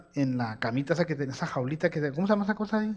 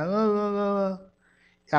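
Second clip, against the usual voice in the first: put-on sick baby whimper; 4 semitones higher, much slower than usual. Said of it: "me decía". (gemido suave de bebé enferma)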